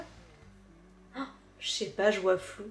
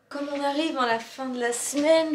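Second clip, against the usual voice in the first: usual speaking voice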